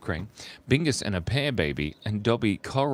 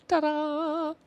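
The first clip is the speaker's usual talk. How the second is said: ta-da reveal. Real, not acted. Reveals finished drawing.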